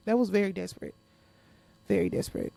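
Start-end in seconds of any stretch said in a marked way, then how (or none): 1.9-2.5 unvoiced, mocking echo; whispered scoffing repeat; muttered aside. Real, not acted. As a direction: breathe the words out as a scornful aside.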